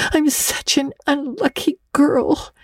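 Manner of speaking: put-on high, slow, mournful girl's voice.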